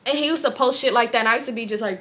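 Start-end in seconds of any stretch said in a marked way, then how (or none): none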